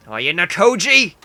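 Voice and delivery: loud, drawn-out shout of the name; scary shout. Acted. Ordering a reluctant friend inside, scary shout.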